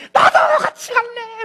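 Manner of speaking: high, slow, tearful cry mixed with laughter; hammed-up tearful graveside plea; sobbing wail. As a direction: wail it like a grieving husband over the grave. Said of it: "계속 울면서 그러더라는 거예요".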